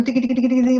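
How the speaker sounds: rapid-fire typewriter chatter; quick, even run of ticking syllables; imitated typing. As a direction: rattle it off like fingers on keys. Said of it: "a máquina uno está escribiendo".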